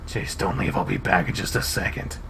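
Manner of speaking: low, soft, near-whispered reassurance; hushed. Acted. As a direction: keep it low and hushed, just for him. Leo quietly reassures Chase.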